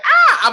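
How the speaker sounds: sudden loud, high "ah!"; mock cry. Host mimicking a fighter, loud high mock cry. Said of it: "he was like".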